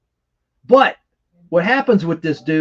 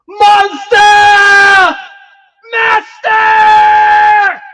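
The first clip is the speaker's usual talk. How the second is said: very high, very loud screamed commands; possessed villain shout; 10 dB louder, much slower than usual. Retold or imitated. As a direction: bellow it like a mind-controlled henchman. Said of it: "I'm gonna do an impersonation".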